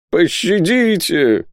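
high, drawn-out pleading wail; begging for mercy. Acted. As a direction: implore them, stretching the word high. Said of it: "взмолился Исаак". (жалобная мольба, высоко и протяжно)

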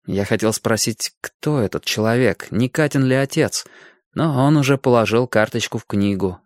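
ordinary delivery

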